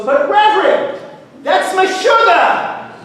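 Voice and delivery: loud, high, drawn-out objection; incredulous protest. Acted. Farmer objects to bringing the cow indoors, incredulous protest. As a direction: stretch the words out in comic disbelief. Man protests the goat advice.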